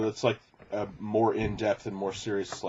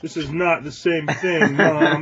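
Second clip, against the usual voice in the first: put-on louder, slow whining child, laughing; whiny kid; 7 semitones higher, 8 dB louder, slower than usual. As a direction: protest like a stubborn kid. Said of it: "how your parents try to".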